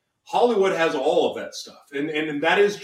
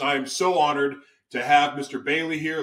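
ordinary delivery

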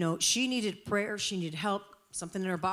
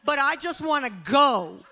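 loud, high, comic plea to escape; exasperated pleading; 7 semitones higher, 9 dB louder than usual. Retold or imitated. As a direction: beg off loudly, half laughing. Acted plea to be left alone.

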